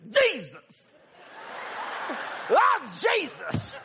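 high, loud holler, drawn out; spiritual outcry. Retold or imitated. Young worker handed a hundred dollars, hammed-up praise shout. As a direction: imitate someone overcome, crying out in praise. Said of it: "they get spiritual".

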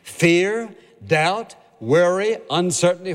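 each word lifted and spaced apart; emphatic list. Acted. Preacher naming the fears of believers, emphatic. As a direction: punch each fear slowly.

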